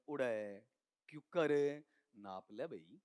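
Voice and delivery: drawn-out Haryanvi reply; Haryanvi dialect. Acted. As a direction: answer slowly in rustic Haryanvi. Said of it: "बहुत बार बोलता हूं ना हरियाणवी". (हरियाणवी लहजे में खींचकर जवाब)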